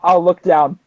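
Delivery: ordinary delivery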